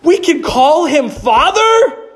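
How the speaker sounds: high, amazed gasp of discovery; awed realization, slowing at the end; sudden realization. Retold or imitated. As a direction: struck with wonder, pitch up high. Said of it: "it hit me".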